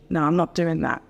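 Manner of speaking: ordinary talk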